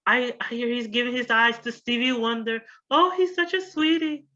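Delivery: very high, gushing schoolgirl voice; high school girl. Retold or imitated. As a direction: gush up high like a starry-eyed teen. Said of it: "And she's like".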